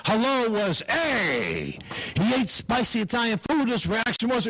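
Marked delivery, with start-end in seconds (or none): 0.9-1.8 lazy grunt. Retold or imitated. Uncle's grunt for hello.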